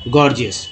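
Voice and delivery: "गॉर्जियस" with first g hard, second as j; hard then soft g. Shown as a demonstration. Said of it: "पहला जी ग, दूसरा जी ज".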